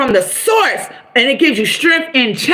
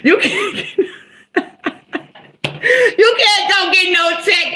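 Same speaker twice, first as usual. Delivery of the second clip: laughing while talking; 6 semitones higher than usual. Real, not acted. Retelling lady's line, cracking up.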